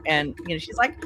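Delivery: ordinary delivery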